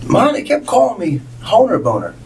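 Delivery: put-on high, hurt little boy; whiny kid voice. Boy complaining to his mom about the teasing, high and whiny. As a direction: play the teased third grader, pitched well up.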